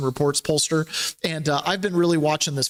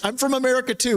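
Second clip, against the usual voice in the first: higher-pitched mimicked claim; retold captive; 7 semitones higher, faster than usual. Last captive claiming to be American too, high-pitched mimicry.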